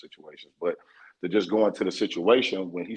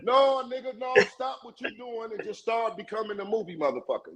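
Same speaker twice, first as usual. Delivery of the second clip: animated higher; 11 semitones higher than usual. Real, not acted. Heated banter with friend.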